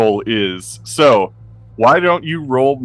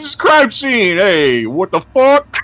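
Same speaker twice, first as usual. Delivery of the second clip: high, raised yell to halt; yelling cop; 11 semitones higher, 3 dB louder, slower than usual. Pretend. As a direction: call out to trespassers. Cop yelling at crime scene.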